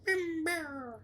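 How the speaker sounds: tiny high creature squeak, drawn out; high cutesy mumbling falsetto; Gizmo. Retold or imitated. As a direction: squeak it softly in a little critter voice. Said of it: "he's like".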